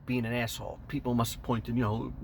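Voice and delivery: usual speaking voice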